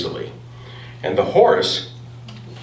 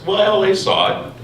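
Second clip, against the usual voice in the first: ordinary talk, nothing marked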